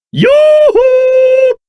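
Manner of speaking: high, drawn-out sing-out call. Acted. Calling to catch someone's attention, high and drawn out. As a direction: hail them with a high "yoo-hoo".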